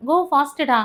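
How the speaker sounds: loud, high push to hurry; urgent shout. Acted. Mahen urging Kavinaya to move fast, shouting. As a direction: hurry her up, loudly.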